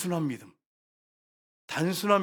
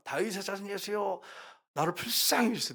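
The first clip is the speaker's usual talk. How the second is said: a mild, half-voiced plea rather than a real shout; first cry to Jesus, held back; retold pleading cry; 5 semitones higher, 5 dB quieter than usual. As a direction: let the woman's cry start small, pleading. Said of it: "나와가지고 외칩니다".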